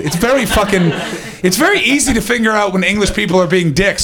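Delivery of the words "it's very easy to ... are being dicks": normal talking voice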